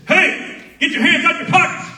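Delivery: high, loud drill sergeant bark; retold shout. Drill sergeant yelling at trainees from thirty feet, loud bark. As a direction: yell it across the field at trainees. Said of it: "I'll tell you what he says".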